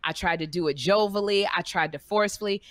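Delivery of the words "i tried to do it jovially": ordinary talk, nothing marked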